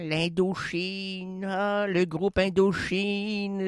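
a languid imitation of Marguerite Duras; relaxed Duras voice. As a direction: drift through the words lazily. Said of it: "elle parlait comme ça, très relax".

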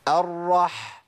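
الرح with a long audible ح from mid-throat; held throat friction closing الرح; firmly placed ح. Shown as a demonstration. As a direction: press out the breathy ح at the end of الرح. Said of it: "ولازم أمكن لمخرج الحرف".

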